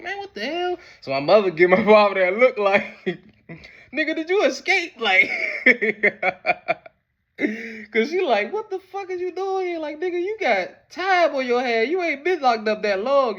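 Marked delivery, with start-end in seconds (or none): 0.0-0.8 high startled exclamation; mother can't believe her eyes; shocked mother. Retold or imitated. Mother shocked to see the father home. 3.9-13.4 high, incredulous mother's voice; mother confronting. Retold or imitated. Mother demanding to know if father escaped.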